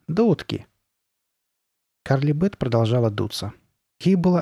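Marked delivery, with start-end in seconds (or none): 0.1-0.7 high, amused brush-off; amused dismissal. A narrator's reading. Girl brushing off her friend's threat, amused. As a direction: dismiss the threat lightly, pitched up. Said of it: "Сабрина рассмеялась".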